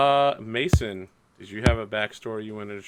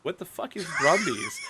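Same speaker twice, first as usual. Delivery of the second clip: retold bafflement. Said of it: "And I was like".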